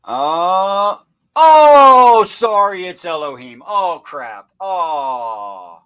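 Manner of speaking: hammed-up disappointment, starting big and dropping; mock dismay. Host faking surprise at a set-up result, mock dismayed. Staged letdown, set up beforehand.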